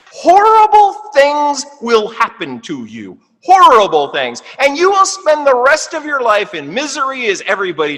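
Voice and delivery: emphatic loud warning. Real, not acted. Teacher warning students away from the woman's house, loudly. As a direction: hammer home the danger.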